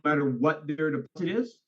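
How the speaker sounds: ordinary delivery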